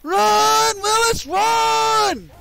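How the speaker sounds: high, loud yell to run; panicked shout. Real, not acted. Yelling at a teammate to run, panicked shout. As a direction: scream at the team to flee.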